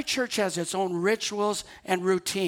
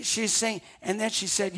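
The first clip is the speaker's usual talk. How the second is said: ordinary talk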